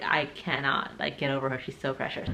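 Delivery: usual speaking voice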